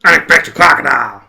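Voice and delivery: loud, hammed-up Australian crocodile-wrestler accent; over-the-top Australian accent, booming; mock Australian accent. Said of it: "you sound like you wanna go and wrestle crocodiles".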